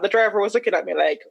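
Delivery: usual speaking voice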